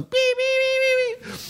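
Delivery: very high, slow drawn-out beeps; high beeping radar detector on the highway. Retold or imitated. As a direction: imitate the detector going off. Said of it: "radar detector on there".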